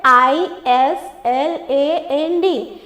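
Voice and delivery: slow letter-by-letter reading; spelling out. Shown as a demonstration. Teacher reading the sixth word's spelling, spelling out. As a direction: spell it slowly, each letter apart. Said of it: "देखिए spelling पढ़ते हैं".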